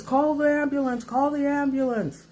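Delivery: high-pitched urging, repeated without a pause; urgent friend. Retold or imitated. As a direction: imitate a friend insisting on help. Friend urging her to call.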